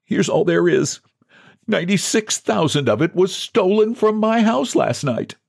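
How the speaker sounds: high-pitched pleading explanation; piteous, high voice of a desperate man; desperate John. Acted.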